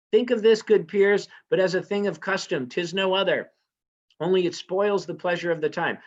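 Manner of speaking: smooth it over loudly and fast; queen loudly reassuring peers at the banquet; urgent hostess. Acted. Lady Macbeth excusing him to guests.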